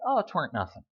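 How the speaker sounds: ordinary talk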